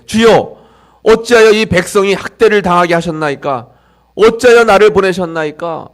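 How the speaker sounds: 높고 큰 소리로 하나님께 따지는 모세; 억울함을 담아 하늘에 외치듯이; 모세의 부르짖음. Acted. 모세의 항의 기도를 재현. (설교자가 모세가 되어 크게 부르짖는 항의)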